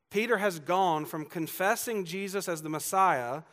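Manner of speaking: ordinary talk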